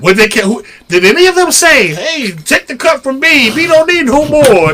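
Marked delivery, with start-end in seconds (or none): 1.9-4.7 higher mock plea from onlookers; raised, mocking quote of others; mimicked commenters. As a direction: lightly imitate someone butting in.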